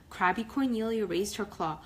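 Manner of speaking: ordinary delivery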